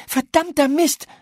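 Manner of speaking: high, loud curse as she runs off; cursing shout. A narrator's reading. Paula cursing as she runs to the garden, loud. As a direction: spit out the curse and bolt. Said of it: "rief sie".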